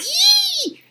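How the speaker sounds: very high loud squeal; frightened squeal. Acted. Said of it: "squealed with fright".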